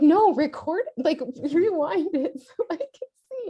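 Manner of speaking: excited laughing plea. Retold or imitated. Mother eagerly asks son to rewind.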